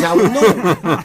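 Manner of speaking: slow, laughing words; drawn-out reply. Retold or imitated. Actor begging the director to explain, slow and laughing. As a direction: drag each word out slowly. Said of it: "I says".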